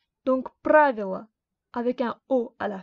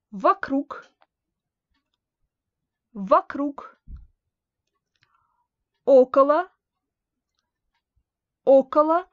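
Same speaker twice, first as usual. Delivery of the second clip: each preposition called out louder, twice, with long pauses; loud drill; 5 dB louder, slower than usual. Shown as a demonstration. Teacher drilling the genitive prepositions loudly for the class. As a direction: call out every preposition twice for students to repeat. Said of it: "quelques prépositions".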